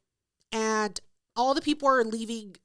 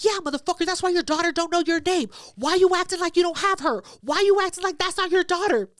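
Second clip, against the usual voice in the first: going off; 7 semitones higher than usual. Retold or imitated. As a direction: keep hammering him in public. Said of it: "Just going off".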